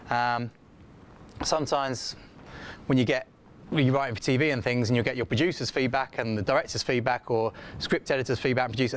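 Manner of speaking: ordinary delivery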